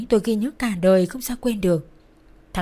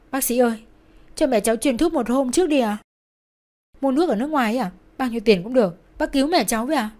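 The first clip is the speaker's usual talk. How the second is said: higher-pitched urgent plea; desperate pleading; 4 semitones higher, 3 dB louder than usual. A narrator's reading. Daughter begging doctor to save her mother, pleading. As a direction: beg the doctor to act.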